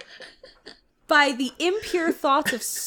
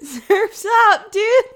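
loud, higher surfer call; surfer shout; 7 semitones higher, 9 dB louder, slower than usual. Shown as a demonstration. Showing what surfers really say, loud surfer call. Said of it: "they say".

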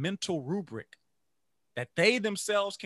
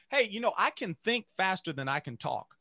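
ordinary delivery

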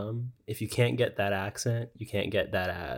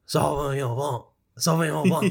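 put-on French accent with the mouth kept open, higher and louder; exaggerated French accent, mouth agape, building louder; mock French accent; 6 semitones higher, 5 dB louder than usual. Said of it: "keep my mouth just agape".

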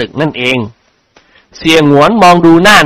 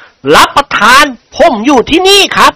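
high, slowed servant's call; mild acted yell, drawn out; 10 semitones higher than usual. A narrator's reading. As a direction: call out in protest, pitched up. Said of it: "ร้องตะโกนลั่น".

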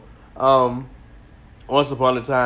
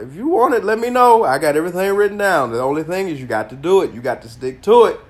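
eager and loud; excited; 7 semitones higher, 6 dB louder than usual. Real, not acted. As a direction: pitch up, sell it hard. Excited offer to followers.

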